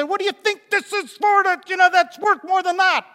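high, loud mock rant at the driver; imitating his old angry self yelling; hammed-up angry yell; angry confrontation. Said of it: "would have hauled him out and said".

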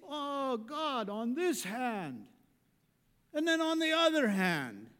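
lightly voiced Tevye pleading with God; a hint of Tevye haggling with God; Tevye praying. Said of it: "He get".

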